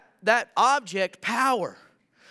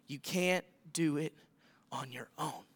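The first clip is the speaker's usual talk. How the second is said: low, soft, fading almost to a whisper; hushed; 7 semitones lower, 10 dB quieter than usual. Acted. Preacher voicing Jesus to his disciples, hushed and fading. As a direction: let it sink to a near-whisper.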